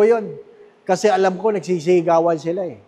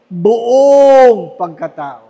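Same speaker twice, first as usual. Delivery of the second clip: "Buong pagkatao" slow and much louder, each word weighted; emphatic; 7 dB louder, much slower than usual. Real, not acted. Preacher stresses whole self.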